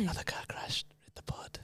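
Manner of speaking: whispered under the breath. Real, not acted. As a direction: hush it, barely voiced. Hushed aside on air.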